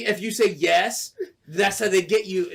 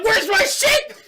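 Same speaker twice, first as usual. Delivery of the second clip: very high, loud yell; outraged demand; 5 dB louder, slower than usual. Retold or imitated. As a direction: reenact a furious demand for your money. Retelling outrage over missing money.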